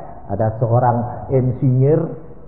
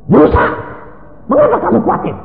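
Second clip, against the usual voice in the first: high, forceful self-exhortation; talking to oneself; 14 semitones higher, 3 dB louder than usual. Acted. Preacher acts out a believer talking to himself, high exhortation. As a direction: urge yourself to trust, pitched high and strained. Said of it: "ngomong dewek gitu loh".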